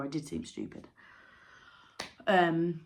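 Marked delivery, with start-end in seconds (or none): 0.0-0.9 mock deflated self.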